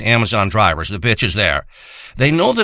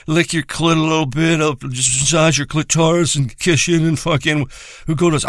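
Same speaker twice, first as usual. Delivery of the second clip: mock tender lover. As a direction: sneer it as a soppy gentle lover. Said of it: "not like you treat some bitch".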